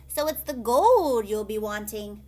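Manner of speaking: loud, sharp accusation. Acted. Leprechaun accusing old Pat of wanting gold, loud and sharp. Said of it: "declared the leprechaun sharply".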